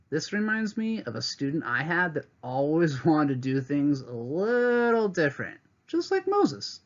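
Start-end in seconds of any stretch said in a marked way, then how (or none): 5.9-6.8 much higher, lilting; delighted. Real, not acted. Teacher smiling about her old student, delighted lift. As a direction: sing out the name with delight.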